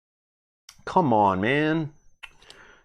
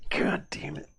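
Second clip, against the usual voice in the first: whispered curse; 8 dB quieter, slower than usual. Real, not acted. Bad card draw.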